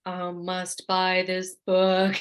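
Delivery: slow, drawn-out trance voice; compelled zombie. Acted. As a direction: drag each word as if hypnotized.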